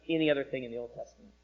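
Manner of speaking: normal talking voice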